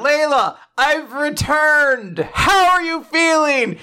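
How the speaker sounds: high, drawn-out heroic call; shouting out. Retold or imitated. Prince outside calls up to Layla, shouting out. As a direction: call up grandly to a window, acted. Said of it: "from outside the window shouts out".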